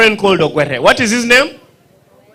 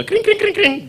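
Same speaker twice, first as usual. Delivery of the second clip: high kring kring ring; bicycle bell; 3 dB quieter than usual. Retold or imitated. Ogwere ringing his bicycle bell at Damien. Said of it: "He rings the bell".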